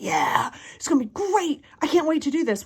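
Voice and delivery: mock eager. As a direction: play the overeager new-goal setter. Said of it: "You're like".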